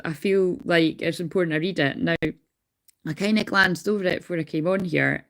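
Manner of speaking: usual speaking voice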